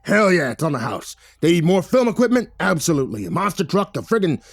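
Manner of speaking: loud, emphatic boss laying down orders; bossy, big-hearted bluster, punching each order; gruff film boss Victor. Acted. Boss stressing that the film crew be kept happy, loud and emphatic.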